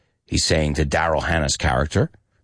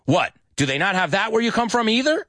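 high-pitched exasperated sarcasm; acted loss of patience; losing patience; 13 semitones higher than usual. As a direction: speed up into an incredulous, high question. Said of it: "And he's losing his patience".

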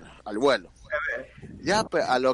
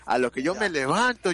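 normal talking voice

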